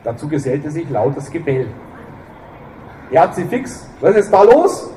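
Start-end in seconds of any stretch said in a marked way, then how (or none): 3.1-4.9 loud, high call down the hall. Acted. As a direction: shout it over your shoulder. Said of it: "rief ... hinaus auf den Flur".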